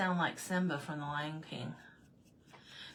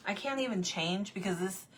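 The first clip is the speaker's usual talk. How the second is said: normal talking voice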